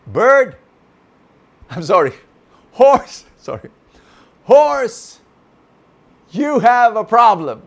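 high, loud, playful bird voice. A narrator's reading. Bird playfully tells the horse he has a problem. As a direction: tease the horse brightly.

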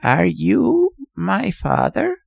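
put-on slow, high, cracked old man; cracked, ancient voice. A narrator's reading. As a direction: ask in a creaky, aged voice. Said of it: "spoke in a cracked and ancient voice".

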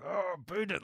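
high, muffled through a mouth guard. Retold or imitated. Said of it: "he'd talk through his mouth guard like".

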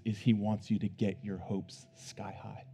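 ordinary talk, nothing marked

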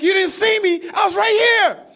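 very high, very loud outraged yell; furious shout. Retold or imitated. Stranger yelling at the driver who nearly hit him, furious shout. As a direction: scream it back at him in disbelief. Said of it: "He's like".

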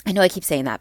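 ordinary talk, nothing marked

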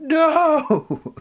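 long, high wail of protest; hammed-up anguished "No!"; freaked-out scream. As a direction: scream it like an outraged child. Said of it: "And I start freaking out".

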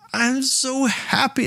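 very high, slow, swooning king; giddy king. Retold or imitated. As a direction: squeal it like a smitten king. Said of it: "he's like".

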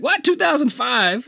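high, incredulous yelp; mock shock. Kid hearing the movie's opening, high shocked voice. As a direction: react like a stunned kid.

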